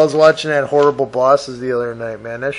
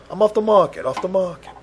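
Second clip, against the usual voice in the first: higher-pitched, spoken rather than crooned; playful quick repeat; 6 semitones higher, faster than usual. Pretend. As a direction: say it higher and briskly, without stretching.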